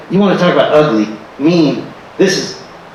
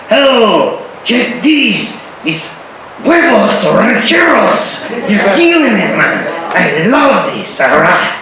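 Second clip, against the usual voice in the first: put-on higher, slow Salvadoran-accented praise; 6 semitones higher, slower than usual. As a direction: hype him up with a Salvadoran accent. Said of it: "They're like".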